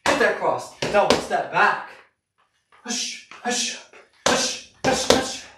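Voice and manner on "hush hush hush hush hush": sharp whispered hush with each punch; hush exhales. Shown as a demonstration. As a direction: breathe out hard on every hush. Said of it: "Letting those hush, hush punches snap out".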